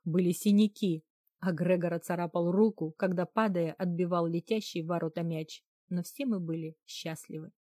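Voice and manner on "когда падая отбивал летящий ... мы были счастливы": usual speaking voice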